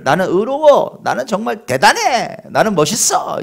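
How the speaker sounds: mock-proud self-congratulation, mildly done; smug self-praise. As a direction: preen with self-satisfied pride. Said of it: "이런 사람들이었어요".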